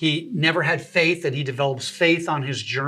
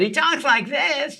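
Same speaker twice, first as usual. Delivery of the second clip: high, drawn-out impression of a talk-show host; Jay Leno; 10 semitones higher, slower than usual. Demonstrating how the comedian talks, high imitated voice. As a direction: pitch it way up and stretch each word. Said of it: "Jay Leno doesn't know that".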